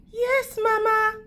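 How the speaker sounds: put-on high, sweet child's answer; dutiful little girl's high reply. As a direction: pipe it up like an obedient little girl.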